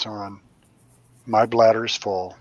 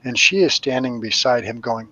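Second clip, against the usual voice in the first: ordinary delivery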